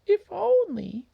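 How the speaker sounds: high wistful little mouse; small mouse voice. Acted. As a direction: sigh it like a tiny dreamer.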